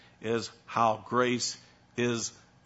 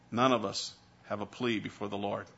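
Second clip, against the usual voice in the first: ordinary talk, nothing marked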